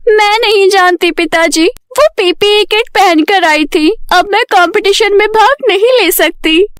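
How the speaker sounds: high-pitched, dejected daughter; upset Payal. Acted. Payal tells her father she cannot compete, upset.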